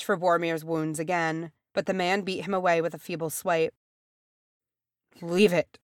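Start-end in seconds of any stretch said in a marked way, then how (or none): 5.2-5.8 mildly bitter dismissal. A narrator's reading. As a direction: push help away bitterly. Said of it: "he said bitterly".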